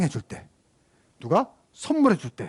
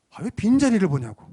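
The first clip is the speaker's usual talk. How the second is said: ordinary talk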